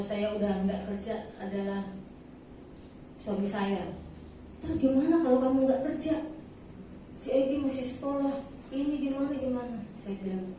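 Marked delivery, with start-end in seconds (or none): 4.6-9.8 imitating a fretting husband, higher-pitched; anxious retold questions in a raised voice; worried husband. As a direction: mimic a spouse worrying aloud.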